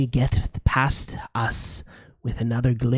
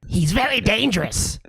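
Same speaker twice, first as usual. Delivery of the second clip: high, ominous gargoyle voice; 9 semitones higher than usual. Acted. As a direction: warn them like a gleeful stone gargoyle. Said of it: "says the gargoyle on the left".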